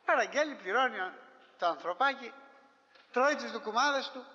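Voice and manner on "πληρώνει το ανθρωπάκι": ordinary talk, nothing marked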